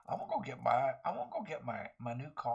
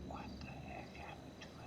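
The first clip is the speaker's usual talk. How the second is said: barely voiced whisper to self; 15 dB quieter than usual. Real, not acted. Player whispering to himself about the vanished car. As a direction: breathe it under your breath. Car gone, muttered under breath.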